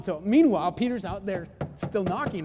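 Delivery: ordinary talk, nothing marked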